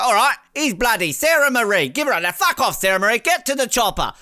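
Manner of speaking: high, loud mock send-off; hammed-up host shooing her to the chopper; impatient Dicko. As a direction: hurry her out like a pushy host. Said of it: "He's like".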